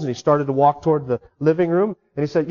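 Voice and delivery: ordinary talk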